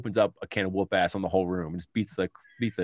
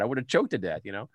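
ordinary talk, nothing marked

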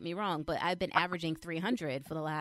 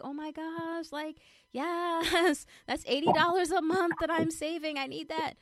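much higher, squealing with delight and a laugh; thrilled; 11 semitones higher, 4 dB louder than usual. Retold or imitated. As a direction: burst out over saving the money. Reacts to fee being waived.

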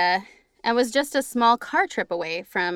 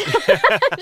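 high laugh; 13 semitones higher, 7 dB louder than usual. Real, not acted. Laughing at own story.

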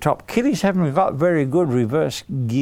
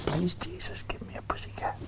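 put-on much softer whispered child's prayer; 4 semitones higher, 14 dB quieter than usual. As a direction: whisper the prayer for the kids to repeat. Hannah prays, prompting children.

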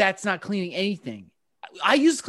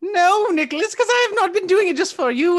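witch voice; 8 semitones higher, 4 dB louder than usual. Acted. Witch persona insists.

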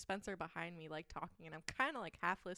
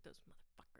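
whispered under the breath, no voice; muttered curse; 11 dB quieter than usual. Retold or imitated. Manager cursing customers in the kitchen, whispered. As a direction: hiss it once out of earshot.

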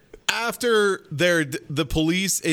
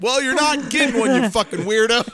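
mock librarian insult; 6 semitones higher, 4 dB louder than usual. Mocking librarian voiced.

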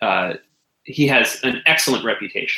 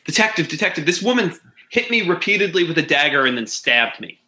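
higher-pitched, urgent accusation of Agnes; panicked accusing Witherspoon; 6 semitones higher than usual. Acted. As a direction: point and cry out to the detective, pitched up.

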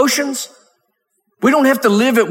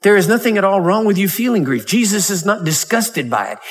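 ordinary talk, nothing marked

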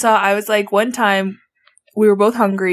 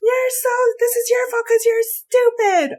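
high, slow mock scolding; hammed-up angry sibling; imitated sister yelling; 14 semitones higher than usual. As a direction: blame her in a high, stretched voice. Imitates sister blaming her.

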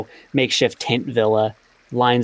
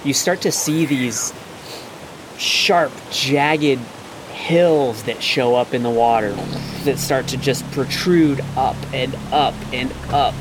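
slow, hushed build-up; game master scene setting; slower than usual. A narrator's reading. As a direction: draw the players in slowly, revealing a monster. GM describes the dragon turtle's emergence.

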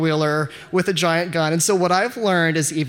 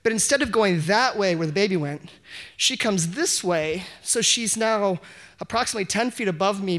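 ordinary delivery

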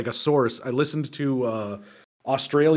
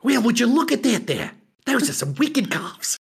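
a light, hammed-up Australian accent, slightly higher; 6 semitones higher than usual. Joking with a friend about an Aussie, mock Australian accent. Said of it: "how does the A go?".

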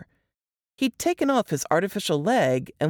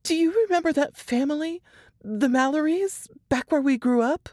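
higher, strained voice of a frightened woman; Laura reliving the horror, voice pitched up; shaken young woman; 11 semitones higher than usual. A narrator's reading. Laura telling Jess and Nate her ordeal, higher and shaken.

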